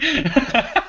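high-pitched laugh; high giggling laugh. Real, not acted. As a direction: burst out laughing at your own joke. Laughs at own remark.

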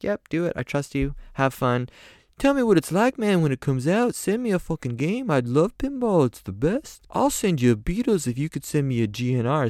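high friendly British voice. Retold or imitated. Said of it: "He's like".

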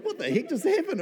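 high, laughing what-the-heck; retelling a baffled moment, laughing; amused disbelief. Retold or imitated. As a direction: laugh through the question in disbelief. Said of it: "And I was like".

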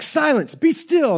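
high-pitched mock command to the kids; mock parent command. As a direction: play the helpless parent ordering silence, way up high.

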